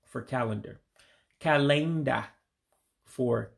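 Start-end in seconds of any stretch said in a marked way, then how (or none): 1.4-2.2 "Calenda" as kah-LAYN-dah, ending open on ah; Igbo pronunciation. Shown as a demonstration. Teacher repeats Igbo word for learners, long middle A. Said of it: "of saying the English word".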